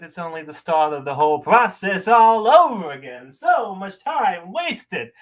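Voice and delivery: slow, theatrical dressing-down with wide pitch swings; scornful monologue. Acted. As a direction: berate someone in measured, acted bursts, punching key words. Performs audition monologue.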